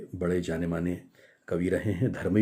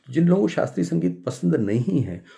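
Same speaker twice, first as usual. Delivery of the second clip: ordinary delivery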